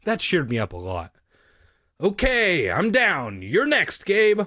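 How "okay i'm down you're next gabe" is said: high, loud call from below. A narrator's reading. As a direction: holler up to someone overhead. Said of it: "Uncle Ben called up to me".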